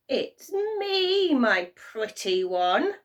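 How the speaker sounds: high then slow, loud cackling goblin voice; cackling imp. A narrator's reading. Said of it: "he cackled".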